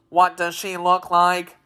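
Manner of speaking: robotic, uninflected question; mechanical machine man. A narrator's reading. Tik-Tok asking about the princess, robotic monotone. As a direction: ask it all on one note.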